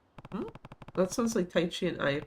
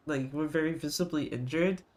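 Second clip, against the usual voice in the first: normal talking voice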